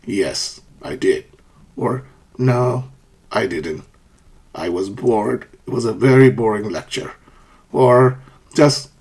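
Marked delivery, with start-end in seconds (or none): 0.0-1.2 low, soft falling "Yes"; settled low fall on "Yes, I did"; downward intonation. Shown as a demonstration. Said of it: "we use a downward intonation". 2.4-7.1 low falling "No, I didn't"; downward intonation. Shown as a demonstration. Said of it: "So pitch falls at the end".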